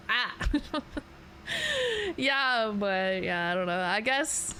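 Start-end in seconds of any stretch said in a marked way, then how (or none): 0.1-0.8 Boston accent. Shown as a demonstration. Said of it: "I know their accent". 1.5-2.1 very high short giggle. Real, not acted. Laughs after accent try.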